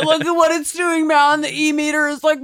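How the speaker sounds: high, loud and thrilled; excited ghost hunter. Pretend. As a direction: hype up the readings.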